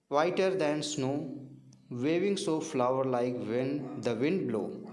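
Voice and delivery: slow, measured poem recital; poem model reading. Shown as a demonstration. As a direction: read each line slowly, as a model for the class. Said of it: "model reading ही करना होता है".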